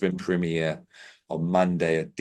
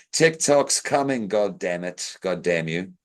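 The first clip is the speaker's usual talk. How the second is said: ordinary delivery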